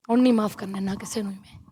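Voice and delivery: low whispered vow. Real, not acted. (ਫੁਸਫੁਸਾ ਕੇ ਸਹੁੰ)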